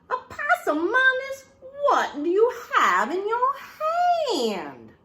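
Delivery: high, loud, drawn-out mama call; a mother calling out her child in alarm; scolding mama. Acted.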